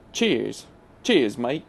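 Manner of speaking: amateur British "cheers, mate"; a shaky stab at British, veering Australian; attempted British accent. Shown as a demonstration. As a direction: try a British accent, not quite landing it. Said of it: "I can't really do a, uh, British accent".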